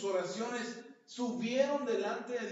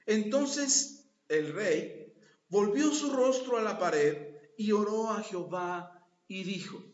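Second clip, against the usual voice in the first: slow, loud reading of the verse; solemn scripture reading; 7 dB louder, slower than usual. Acted. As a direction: read the verse slowly with weight. Lectura bíblica en voz alta. (lectura solemne y lenta)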